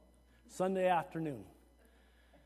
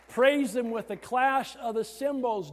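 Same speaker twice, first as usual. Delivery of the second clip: loud, raised Scripture reading; emphatic preacher's recital; psalm declaimed; 5 semitones higher, 6 dB louder, slower than usual. Real, not acted. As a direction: proclaim it to the congregation.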